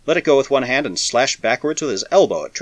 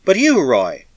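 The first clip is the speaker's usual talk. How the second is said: higher and louder protest; a tearful, defiant plea; pleading protest; 6 semitones higher, 5 dB louder, slower than usual. A narrator's reading. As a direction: cry it out in protest. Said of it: "Her sullen lips were angry".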